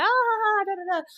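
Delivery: high airy put-on brush-off; lightly mocking high voice; mock airy reply. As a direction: toss it off sweetly. Her mock casual reply.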